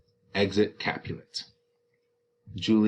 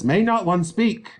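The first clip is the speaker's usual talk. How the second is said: high indignant nurse; 10 semitones higher, 5 dB louder than usual. Acted. Nurse protests.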